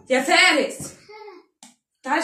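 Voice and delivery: mother calls her son Fares over to help, loud call. Real, not acted. Calling son across room.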